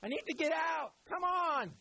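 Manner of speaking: high, pleading call from inside a tomb; hammed-up trapped man asking to be let out; impatient Jesus knocking. Preacher voicing Jesus stuck inside the tomb, mock impatient. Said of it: "Jesus wasn't on the inside going".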